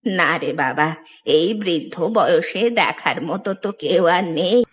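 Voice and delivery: put-on low-pitched old granny voice; old woman. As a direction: answer as a weary, aged mother. Old woman answers the goldsmith. (বৃদ্ধার নিচু গলা)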